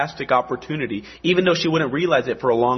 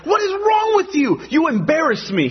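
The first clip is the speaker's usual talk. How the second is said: mock outraged merchant; 12 semitones higher than usual. Merchant scolding the American for paying full price, mock outrage. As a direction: act the offended bazaar trader scolding. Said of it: "He's like".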